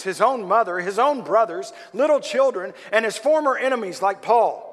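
loud, high, fervent sermon; preaching at full force. Acted. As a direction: preach it out to the crowd.

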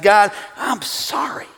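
hushed remorseful prayer; contrite prayer. Retold or imitated. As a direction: confess quietly on your knees. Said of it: "on my knees ... and I said".

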